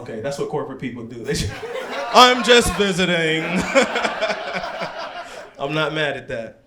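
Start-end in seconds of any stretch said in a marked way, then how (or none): 2.1-3.4 loud, higher mock of the guest's evasive answer. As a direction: parrot his answer loud and smug. Mocking audience member's reply.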